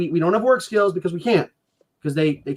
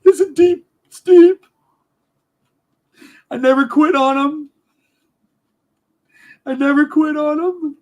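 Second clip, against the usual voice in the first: crying; 6 semitones higher than usual. Real, not acted. Father crying over the children he can't see. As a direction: voice breaking, high and tearful. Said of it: "Sorry for crying".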